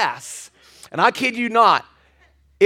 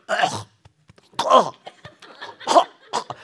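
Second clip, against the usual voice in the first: imitated hacking, gagging dog; dog choking noises; slower than usual. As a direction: cough out short throaty choking sounds. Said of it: "he starts going".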